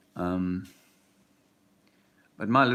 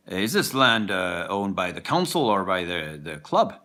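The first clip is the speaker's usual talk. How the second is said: a mock Irish golfer asking his pal, a bit raised; Irish accent; 6 dB louder than usual. Golfer loudly asking his pal about the land, in an Irish accent. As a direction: put on an Irish brogue for the golfer's question. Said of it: "this is my Irish accent".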